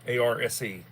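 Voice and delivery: ordinary talk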